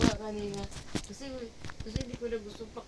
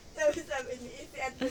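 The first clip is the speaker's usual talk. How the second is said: ordinary delivery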